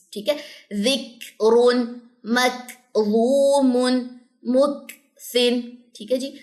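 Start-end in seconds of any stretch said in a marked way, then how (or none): 0.7-5.6 "ذکرون، مکظومن، مکثن" very slow, each k released with a puff; practice demonstration. Shown as a demonstration. Teacher showing how to practise daily, slow drilled "ذکرون، مکظومن، مکثن". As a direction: recite "ذکرون، مکظومن، مکثن" split after the breathy k, long vowels held. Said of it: "اس طریقے سے".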